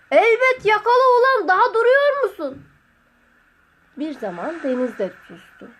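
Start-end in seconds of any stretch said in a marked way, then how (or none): 0.1-2.6 high, loud urging yell; shouting back. A narrator's reading. Master urges distant Sotiri to catch it, shouting.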